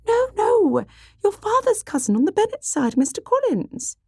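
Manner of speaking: very high, agitated correction; high-pitched, fussy matron; flustered mother. Acted. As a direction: correct them impatiently in a high flutter. Flustered mother corrects her daughter.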